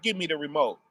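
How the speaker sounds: low, quiet and matter-of-fact. Retold or imitated. God's plain low request to the people. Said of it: "He's like".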